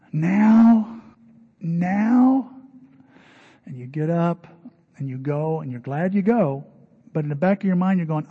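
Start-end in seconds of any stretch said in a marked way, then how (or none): 0.1-2.5 exasperated disbelief. Retold or imitated. Tired caller asked to come.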